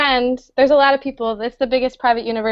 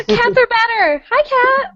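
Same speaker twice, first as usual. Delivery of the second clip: pet voice; 11 semitones higher than usual. Real, not acted. Greets cat on camera.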